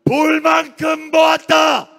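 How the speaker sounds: loud, high, slow shout; fed-up rallying shout. Real, not acted. Orator denouncing the government at a rally, fed-up shout. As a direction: hurl out each word slowly at full volume. Climactic rally declaration of exasperation.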